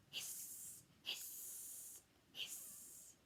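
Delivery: soft drawn-out hisses; snake hisses. Acted. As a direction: hiss like a sneaky snake. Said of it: "Square was afraid of snakes".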